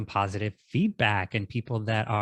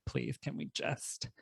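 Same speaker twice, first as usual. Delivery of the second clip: whispered plea; 5 semitones higher, 11 dB quieter than usual. Retold or imitated. Pleading to release the podcast.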